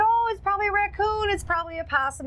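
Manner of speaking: high, feigned innocence; playing dumb. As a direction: pretend you have no idea what's out there. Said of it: "I'm playing stupid".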